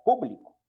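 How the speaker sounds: público, stressed on pú; público as an esdrújula, first syllable strong. Shown as a demonstration. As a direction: land hard on the pú of público. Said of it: "que no es lo mismo que publico".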